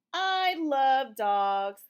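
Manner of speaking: very slow and loud, each word spaced; slow read-aloud. Shown as a demonstration. A mother reading aloud, slow and loud. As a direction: read it out slowly for a toddler. Reads refrain slowly.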